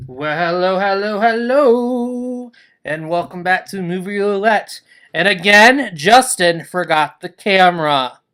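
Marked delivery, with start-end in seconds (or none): none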